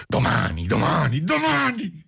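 madman's sudden fury, pitch leaping up; furious cry. A narrator's reading. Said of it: "urlò il pazzo entrando in una subita furia".

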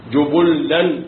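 جبلا said jubullan, damma on jim and ba, doubled lam; جبلا with shadda on lam; jubullan reading. Shown as a demonstration. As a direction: say جبلا as ju-bul-lan. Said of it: "بضم الجيم والباء وتشديد".

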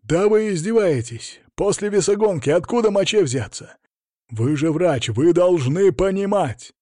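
indignant, nearly yelling; near shout. A narrator's reading. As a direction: raise it almost to a shout, outraged. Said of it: "Тренер уже перешёл почти на крик".

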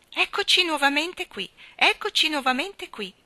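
high, loud entrance call; clown's shout. A narrator's reading. Saturn the clown bounds into the ring, shouting. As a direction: announce yourself loudly, pitched right up. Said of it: "gridò una grossa voce arrocchita".

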